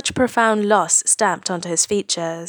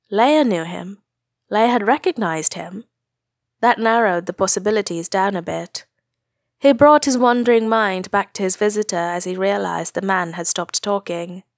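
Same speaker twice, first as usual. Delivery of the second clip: plain reading voice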